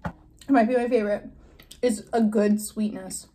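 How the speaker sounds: talking while chewing; savoring chocolate while speaking; mouth partly full. Real, not acted. Seen 1: Right-hand speaker bit chocolate at -5, still chewing while talking.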